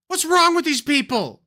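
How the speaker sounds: loud, high fed-up curse; fed-up outburst. Real, not acted. Streamer fed up with online personalities, cursing loudly. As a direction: blow up in frustration. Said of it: "I'm done".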